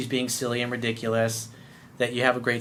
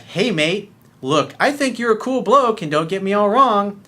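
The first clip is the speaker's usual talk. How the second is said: woman's voice; 6 semitones higher, 4 dB louder than usual. Pretend. Reading woman's text higher.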